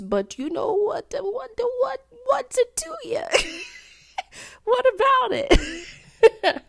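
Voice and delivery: very high, squeaky comic protest; mock defensive. Defending a guy who only texts weekly, mock-defensive squeal. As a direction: play up the indignant excuse in a high voice. Mocks her own excuse-making.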